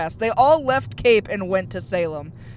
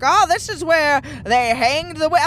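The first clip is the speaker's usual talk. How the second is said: higher, louder mock tour-guide voice; 7 semitones higher, 6 dB louder than usual. Mocking Salem witch tour guides in a higher put-on voice. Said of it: "They're like".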